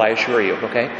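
ordinary talk

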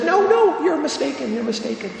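Peter caught out again, scrambling to deny it; panicked denial. A narrator's reading. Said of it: "struck to the heart with fear".

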